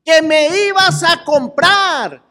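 put-on a child nagging a parent who promised to buy something; whining child. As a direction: play the kid demanding the promised toy. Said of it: "es cuando le dicen".

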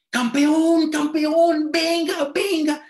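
grito muy agudo y fuerte; llama a todo pulmón, apremiando; llamado urgente a gritos. Retold or imitated. Lina lo jala para que venga. (imitando a quien jala a alguien con urgencia)

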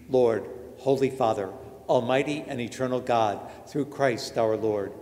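ordinary delivery

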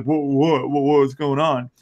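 talking through a mouthful of chip; mouth full. Acted. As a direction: mumble it round a bite of food. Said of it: "his mouth full".

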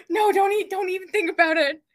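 high, loud playful panic; mock alarmed refusal. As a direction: wave off your sister in mock horror. Refusing sister's haircut offer.

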